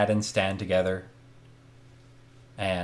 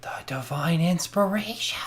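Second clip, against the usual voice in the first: awed whisper, higher; 8 semitones higher, 4 dB quieter than usual. Acted. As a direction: breathe it like a holy secret. Joking reverent aside.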